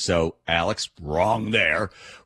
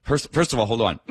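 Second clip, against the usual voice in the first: usual speaking voice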